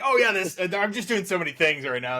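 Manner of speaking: high, hammed-up sudden recollection; teasing imitation. Host teasing busy guest about his project, mock-offhand.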